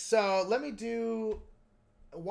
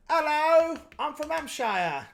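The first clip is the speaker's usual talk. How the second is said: loud, higher hammed-up posh Hampshire farmer accent; 8 semitones higher, 8 dB louder, slower than usual. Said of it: "so posh farmer would be".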